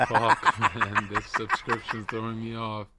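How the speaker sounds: giggling through the words; laughing. Real, not acted. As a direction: crack up while talking. Cracks up mid-reading.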